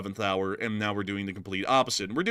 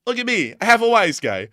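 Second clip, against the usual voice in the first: high, loud giggly voice; chuckling at the wise guy joke; still laughing; 9 semitones higher, 10 dB louder than usual. Real, not acted. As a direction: talk through giggles.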